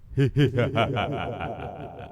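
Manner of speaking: deep, sneaky little chuckle; sly low chuckle. Acted. Sam plotting a trick, sly low chuckle. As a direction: chuckle to yourself over a naughty plan. Sam has a mischievous idea.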